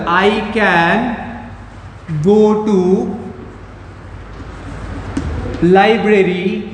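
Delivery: word by word with long pauses; measured, halting board-writing pace; slow dictation. Real, not acted. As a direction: spell it out slowly as if writing it down. Writes out example while speaking.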